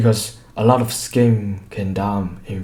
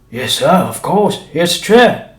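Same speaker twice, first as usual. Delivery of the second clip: higher, louder obsequious voice; eager showman serving the doctor; fawning shopkeeper; 7 semitones higher, 6 dB louder than usual. A narrator's reading. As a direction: grovel to please the gentleman. Shopkeeper fawns over the doctor.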